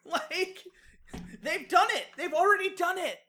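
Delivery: high-pitched indignant insistence; heated rant with a touch of laughter; worked up. Real, not acted. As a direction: plead the point in exasperated disbelief. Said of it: "I'm getting really worked up".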